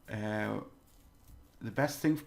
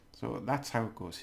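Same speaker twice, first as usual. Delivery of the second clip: usual speaking voice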